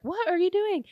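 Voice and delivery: high-pitched incredulous question; acted high-voiced disbelief; mock bewilderment. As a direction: imitate her baffled squeal of a question. Retold baffled reaction to squirming man.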